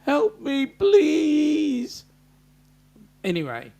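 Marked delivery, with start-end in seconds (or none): 0.1-2.1 much higher, louder mock-helpless whine; imitating Liz's high, pleading help-me tone; whiny plea. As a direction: mimic her pleading like a damsel. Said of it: "with that tone".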